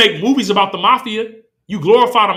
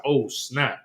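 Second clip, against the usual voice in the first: low, soft, deflated Isaac; dismayed mutter; 9 semitones lower, 11 dB quieter, slower than usual. Retold or imitated. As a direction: mutter it as if just realizing you were tricked. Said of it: "He's like".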